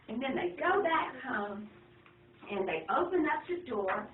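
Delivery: ordinary delivery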